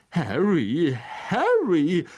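drawn-out, swooping name, twice; impatient. A narrator's reading. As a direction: chide him with exaggerated patience wearing thin. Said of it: "sagte Lockhart ungeduldig".

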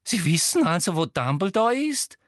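high, rising eager question. A narrator's reading. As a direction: press hungrily for the answer, pitch climbing. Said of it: "sagte Umbridge begierig".

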